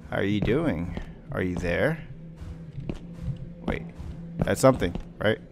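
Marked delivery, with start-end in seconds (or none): none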